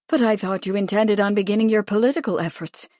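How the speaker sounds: ordinary delivery, nothing marked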